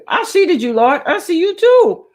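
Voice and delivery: high and loud happy shout-out; high, loud delighted hello; excited greeting. Real, not acted. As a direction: call out to the viewer with delight. Greeting a viewer on livestream.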